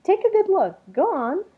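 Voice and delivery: high, loud and eager; bursting with delight at the place; excited creature. A narrator's reading. Creature insists to Something Else, high and thrilled.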